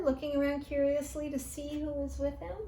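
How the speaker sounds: ordinary delivery, nothing marked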